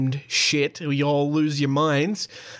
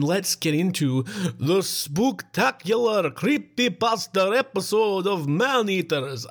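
spooky announcer; 5 semitones higher, slower than usual. Acted.

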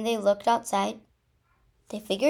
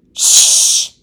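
loud, forceful "shh"; urgent shush; 18 dB louder than usual. Acted. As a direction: shush your sister hard and loud. Interrupts sister to silence her.

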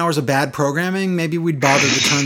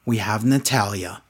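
lightly mocked solemn announcement; imitating Lana; 5 semitones lower, 4 dB quieter than usual. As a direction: drop suddenly into a stilted, lower delivery. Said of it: "Humans don't talk like this".

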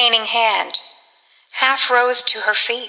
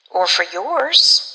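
slow, eerie murmur of a question; odd, involuntary; 3 semitones lower, slower than usual. A narrator's reading. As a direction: let the words slip out slowly, despite yourself. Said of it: "coming from her in an odd fashion, despite herself".

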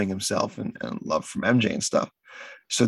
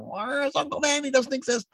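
high, whiny mock nerd; 3 dB quieter than usual. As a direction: squeak it like a whining nerd fan. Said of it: "some nerds out there going".

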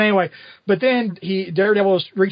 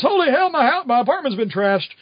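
lightly hammed-up shock, pitched higher; feigned surprise; 7 semitones higher than usual. [name] pretending surprise at his trashed apartment, feigned surprise. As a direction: act surprised, not quite convincingly. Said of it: "he pretends to be surprised".

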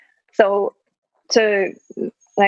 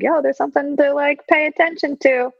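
higher-pitched voiced thought; higher, heads-up quote voice; mock inner alert; 9 semitones higher than usual. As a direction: pipe up high, like flagging something to watch.

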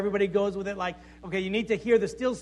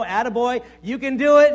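mock encouragement; 7 dB louder than usual. Preacher voicing the attaboy God never gave, mock encouragement. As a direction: cheer them on like a hammed-up coach. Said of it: "there's no word of encourage".

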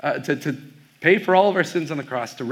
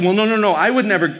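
high, louder flurry of denial; imitated protest; 6 semitones higher, 4 dB louder than usual. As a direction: hurry to deny it, voice lifted. Retold waitress protesting in alarm.

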